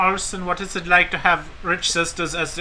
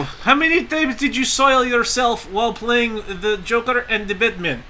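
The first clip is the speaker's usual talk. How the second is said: higher, slow, laughing put-on voice; silly fan voice; 8 semitones higher than usual. Podcaster plays a fan asking Joker actor a crude question, goofy and slow. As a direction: ask it with mock seriousness. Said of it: "it's a different voice".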